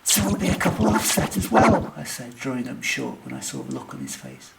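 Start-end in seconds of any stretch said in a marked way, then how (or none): 0.0-1.9 high, loud character voice; hopeful partner. Acted. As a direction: pitch it up, eager for an answer. Partner hopeful, then stops short.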